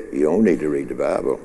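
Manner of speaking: low, soft, quick tempter's aside; the other voice. Acted. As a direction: slip it in low and quick, dismissively. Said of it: "this other voice over here said".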